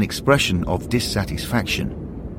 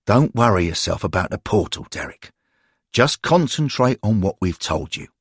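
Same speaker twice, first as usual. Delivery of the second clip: plain reading voice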